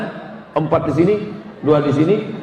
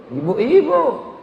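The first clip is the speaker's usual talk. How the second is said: drawn-out answer; 6 semitones higher, much slower than usual. Real, not acted. Answering the question.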